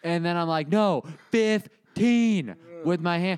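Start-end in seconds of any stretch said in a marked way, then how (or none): 0.7-2.5 drawn out and high, mouthing through glass; slow exaggerated mouthing. To the stranger outside the glass, slow exaggerated mouthing. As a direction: stretch each syllable so they can lip-read.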